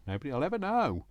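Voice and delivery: quick, high sing-song; sing-song reassurance. Acted. Crafter covering up her number switch, playful sing-song. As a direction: lilt it up high like a playful secret. Conspiratorial reassurance after a fix.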